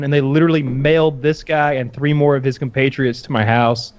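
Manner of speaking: ordinary talk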